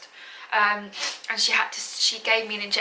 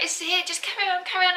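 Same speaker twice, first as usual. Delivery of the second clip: higher-pitched mock encouragement; urging midwife; 9 semitones higher than usual. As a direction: urge her on as the midwife. Midwife urging her to push.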